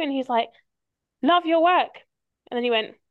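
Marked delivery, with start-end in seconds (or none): 1.2-2.0 stranger's voice. Retold or imitated.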